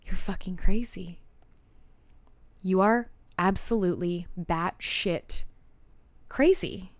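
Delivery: slow, spaced-out retold thought; deliberate disbelief. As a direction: let each word land separately. Retold thought on reading his text.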